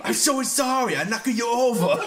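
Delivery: put-on botched Scottish accent. Apologising as the actor to friends, botched Scottish accent. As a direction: overdo a failed Scottish accent, pitched high.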